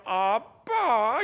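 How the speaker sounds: 아빠 stretched very slowly and sweetly; sweet drawn-out. Shown as a demonstration. Preacher showing a daughter sweetly calling dad, slow. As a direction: melt dad with a long coaxing 아빠. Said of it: "이러면 녹아버려요".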